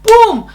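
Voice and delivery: high, loud falling "boom"; explosive sound effect; imitated boom. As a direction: burst it out like a blow. Imitates another punch of pain.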